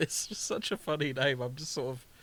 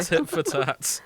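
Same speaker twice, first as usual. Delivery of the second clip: laughing; 4 semitones higher, 6 dB louder, slower than usual. Real, not acted. Laughing answer to recap question.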